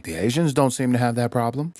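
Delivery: usual speaking voice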